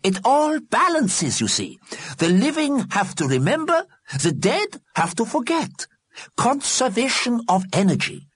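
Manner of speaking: excited, squeaky voice; high, eager squeak of an old scientist; Solomon Einstein. Acted. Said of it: "in his excited, squeaky voice".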